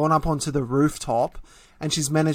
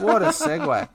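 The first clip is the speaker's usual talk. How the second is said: laughing; 11 semitones higher than usual. Real, not acted. Laughing, repeated higher.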